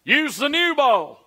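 slow, ringing voice from on high; voice from above. Acted. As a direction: call down an order from heaven. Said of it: "he heard a voice from above".

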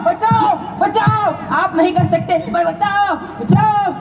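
high, desperate calls for rescue; panicked cry for help. Acted. Doctor to bystanders while being beaten, high panicked cries. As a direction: cry out as you are being attacked. Doctor attacked by patient's relative.

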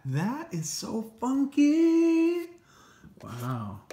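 high drawn-out marveling; amazed. Real, not acted. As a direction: stretch it out in awe. Marveling at the earbuds.

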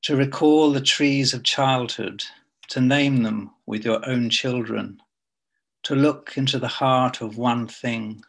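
measured poem recital with long pauses between lines; poetry reading. Acted. Poet reading to an online audience, measured poetry reading. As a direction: read it line by line, letting each phrase hang.

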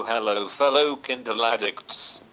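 usual speaking voice

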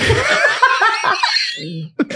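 shrieking burst of laughter. Real, not acted. Laughing at imagined swearing scene.

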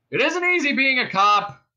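high, loud cop hailing; bullhorn voice. A narrator's reading. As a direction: project it as if through a bullhorn. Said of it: "then the bullhorn again".

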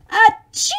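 big loud pretend sneeze. Reading to kids, acting the dragon's sneeze loudly. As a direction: burst out with a dragon's achoo.

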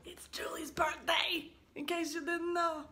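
usual speaking voice

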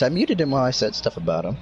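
ordinary talk, nothing marked

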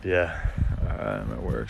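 usual speaking voice

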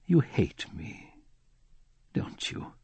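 slow, sad question fading into a whisper; infinitely sad. A narrator's reading. The prisoner to his captor, slowly and sadly fading to a whisper. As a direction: let the sorrow drain the voice away to nothing. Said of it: "said in an infinitely sad voice".

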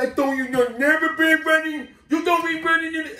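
high, monotone mock scolding; imitated patrol kid. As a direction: recite the rule in a put-on kid voice. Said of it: "He said".

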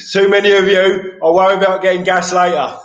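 loud, high, cocky battle cry; defiant shout. Retold or imitated. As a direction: shout it like you're taking on the lot. Retold fight, taunting the gang.

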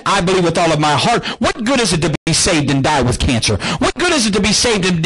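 usual speaking voice

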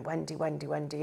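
hushed repeating to memorise; inner voice. Retold or imitated. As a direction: murmur the name to yourself. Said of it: "in my head".

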